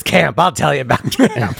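slow, lightly voiced grandmother; a hint of emphatic grandma; interrupting grandmother. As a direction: quote grandma's line with mock weight. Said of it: "a big line in our family".

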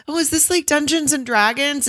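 high, bright, eager question; high bubbly girl voice. Retold or imitated. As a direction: play the excited girlfriend. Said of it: "she's like".

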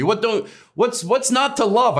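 high, pleading question to the crowd; mock exasperated plea. Comic asking the audience why they aren't enjoying it, pleading. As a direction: beg the audience to love you, voice up high. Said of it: "I said one night".

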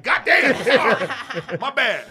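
high, loud surprised laugh; startled apology. Retold or imitated. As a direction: jump back, laughing, and apologize. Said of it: "they gonna be like".

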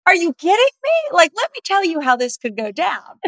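high, loud incredulous outburst, then easing; incredulous disbelief. Retold or imitated. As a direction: blurt it out in amazed disbelief. Retelling her reaction to husband.

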